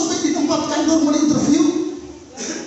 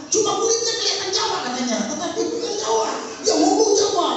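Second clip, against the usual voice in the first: a hint of the wife, higher; wife's voice; 7 semitones higher than usual. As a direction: lightly voice the scolding wife. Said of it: "istrinya tegur". (istri menegur soal bahasa Jawa, suara sedikit lebih tinggi)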